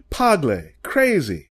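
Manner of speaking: mildly shouted taunt; shouting back. A narrator's reading. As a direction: call it out high at the man. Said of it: "others were shouting back".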